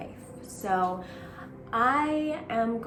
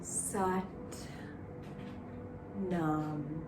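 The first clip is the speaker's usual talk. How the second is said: guided meditation. Acted. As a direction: gently guide the breath. Leads a Sat Nam meditation.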